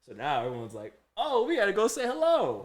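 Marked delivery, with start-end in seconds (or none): 1.2-2.6 high, mock-eager exclamation; eager coworkers. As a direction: imitate excited coworkers chiming in. Coworkers eager to greet his dad.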